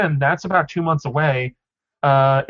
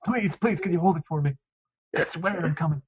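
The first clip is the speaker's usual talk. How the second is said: soft, begging fan on the phone; desperate pleading; 7 dB quieter than usual. Acted. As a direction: beg in a hushed, acted plea. Acted desperate call to a store.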